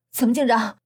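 winded and gasping; out of breath. A narrator's reading. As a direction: ask it while puffing from a scuffle. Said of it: "气喘吁吁地问".

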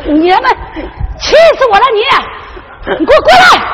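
high, outraged yelling at the couple; furious shout. Acted. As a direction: explode at being fooled. Mother discovers the faked beating. (气急败坏地高声喊)

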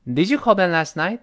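whole line read with the tune rising at the end; teacher's model question, rising; question rise. Shown as a demonstration. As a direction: ask it, voice going up on "night". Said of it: "跟老师一块儿读一下这句话".

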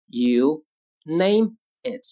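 slow, with a gap between words; word-by-word model. Shown as a demonstration. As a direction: dictate it calmly and slowly. Teacher models phrase word by word.

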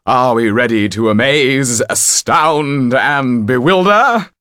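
high, loud, building flourish; rousing showman. A narrator's reading. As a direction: proclaim it like a stage magician. Topolan rouses his troupe.

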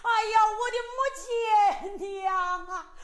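slow, high, loud wailing for his mother; wailing cry. Acted. Son wakes and mourns his drowned mother, wailing. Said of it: "嚎啕大哭".

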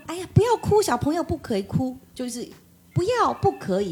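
high-pitched retold "stop crying" scolding; imitated fussy hushing. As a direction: act out a grandmother forbidding tears. Grandmother telling crying child to stop.

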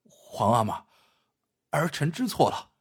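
mild, hushed plea for forgiveness; pleading. A narrator's reading. Son clinging to his emperor father's legs, lightly pleading. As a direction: beg a little, without raising the voice. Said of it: "紧抱着父亲的腿求道".

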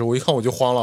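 ordinary delivery

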